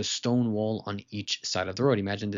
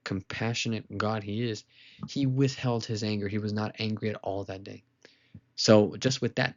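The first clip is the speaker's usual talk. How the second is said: ordinary delivery, nothing marked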